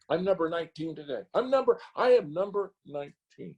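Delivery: high, exasperated rant; ranting and raving. Real, not acted. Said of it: "I'm ranting and raving".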